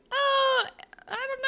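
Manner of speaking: very high, coy squeak; coy hesitation. Retold or imitated. Allie hesitating in front of Johnny, very high and coy. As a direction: squeak it coyly.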